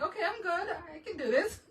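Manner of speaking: put-on confident little girl. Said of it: "I'm like".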